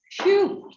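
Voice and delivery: loud high phew of relief; relieved sigh. Real, not acted. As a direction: blow out a relieved breath. Chair relieved after tricky vote.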